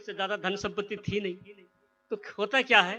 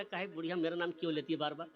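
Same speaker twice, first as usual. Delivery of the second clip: quiet hidden mutter; 4 semitones lower, 10 dB quieter, faster than usual. Acted. Hidden thief talking to himself.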